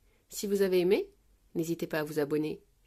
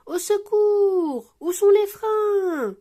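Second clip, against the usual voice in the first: high, loud, long-drawn wail; panicked yell; 12 semitones higher, 9 dB louder, much slower than usual. A narrator's reading. Maman Pig speeding downhill, panicked yell. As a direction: shriek for help, stretching the endings. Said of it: "hurle Maman Pig".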